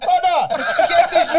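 high, loud, slow laughing panic. Retold or imitated. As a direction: lose it laughing in shock. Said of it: "you're like".

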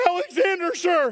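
high, tense soldier's reply; a caught sentry answering in fear; a hint of a terrified soldier; scared guard. Frightened guard answers again.

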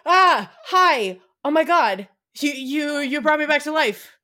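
high, loud, startled little voice; awakened nutcracker. Retold or imitated. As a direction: pop to life, gasping and amazed. Nutcracker comes alive.

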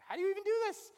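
high, loud disbelieving cry; exasperated cry. Real, not acted. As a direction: throw up your hands, genuinely stumped. Exasperated about finding prayer time.

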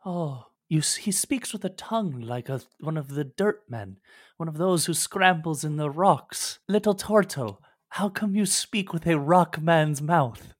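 a grateful, bewildered sea creature far from home; sea creature NPC. Acted. GM voicing the rescued sea creature to the party, lightly lifted and wondering.

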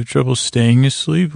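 normal talking voice

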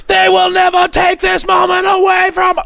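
mock general shouting to his soldiers; rallying general. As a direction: rouse the men before the last stand, shouting. Mock rallying speech to troops.

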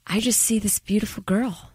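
soft whispered dreamy murmur. Retold or imitated. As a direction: breathe it like a smitten movie star. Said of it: "That's exactly how he said it".

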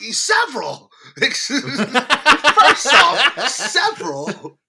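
loud laughing boast. Real, not acted. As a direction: crack up while bragging.

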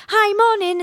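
very high, bright, sing-song phone hello; fake-cheery robot voice answering the phone; eerily chipper high-pitched hello; chirpy robotic greeting. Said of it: "are you a robot?".

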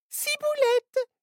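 put-on very high, slow little-girl voice; a giggly tiny girl giving her name; little girl laughing. Little girl tells the new friends her name, giggly and very high. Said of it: "dit la petite fille en riant".

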